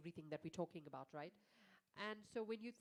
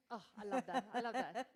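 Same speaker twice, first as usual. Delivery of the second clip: laughing delight; 2 semitones higher, 7 dB louder than usual. Real, not acted. Amused by own nasal voice.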